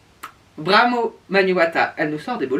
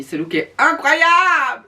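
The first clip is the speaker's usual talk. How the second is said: high, slow, swooning praise; gushing; 9 semitones higher, 4 dB louder, slower than usual. Real, not acted. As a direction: gush over it like a fangirl. Gushing over a favourite look.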